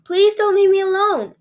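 high, loud begging; desperate plea. A narrator's reading. Little girl begging her dying mother, desperate. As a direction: plead through rising panic.

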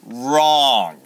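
loud, drawn-out correction; blunt rebuttal. Real, not acted. Preacher rebutting everyone who stops there, loud verdict. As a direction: knock down the happy ending with one loud word.

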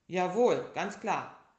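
loud, brisk yes-sir; eager bear. Acted. Bear agrees to bandage the tiger, loud and eager. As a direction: snap to it like a doctor. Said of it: "sagte der kleine Bär".

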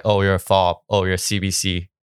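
ordinary talk

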